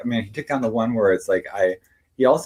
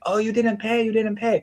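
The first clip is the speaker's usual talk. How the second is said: mock scolding yell; 12 semitones higher than usual. Retelling the store owner shouting that he didn't pay, high mock yell. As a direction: repeat it high, like the owner won't let it go. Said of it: "she's, like, screaming".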